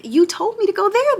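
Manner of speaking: high, loud protest to God; exasperated plea. Retold or imitated. Speaker to the Lord, frustrated about moving, in a high exasperated protest. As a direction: throw it back at the Lord, exasperated.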